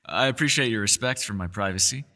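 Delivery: usual speaking voice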